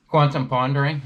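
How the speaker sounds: high, loud segment announcement; announcing a segment. Real, not acted. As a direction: call out the topic title. Announcing a new topic.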